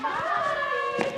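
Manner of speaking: squeaky creature bye; high creature farewell. Acted. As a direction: call goodbye as a tiny animal. Animals bid farewell.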